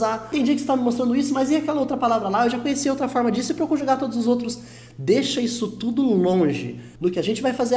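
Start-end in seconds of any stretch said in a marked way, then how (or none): 0.0-4.6 quick, higher, puzzled inner voice; imagined student's questions. Retold or imitated. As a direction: rattle off the doubts like a confused student. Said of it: "O seu cérebro vai começar a querer fazer perguntas".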